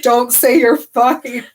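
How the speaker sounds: mock angry outburst. Edward scolds Bella in retold dialogue.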